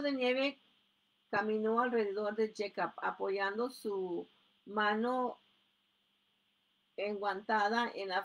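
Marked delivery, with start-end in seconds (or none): none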